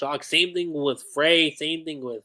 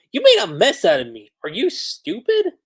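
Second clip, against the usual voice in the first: indignant complaint; 5 semitones higher, 5 dB louder than usual. Retold or imitated. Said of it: "And then Honey over here".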